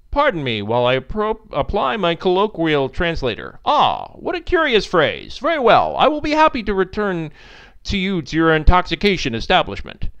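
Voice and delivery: earnest android puzzling out slang; formal former-AI missile. Acted. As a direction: speak with over-formal robotic courtesy.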